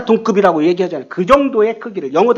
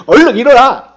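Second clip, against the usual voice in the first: louder, impatient order to get up; urgent wake-up call; 2 semitones higher, 8 dB louder than usual. Pretend. Wife waking her sleeping husband, urgent and loud. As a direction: rouse a sleeper with sudden force.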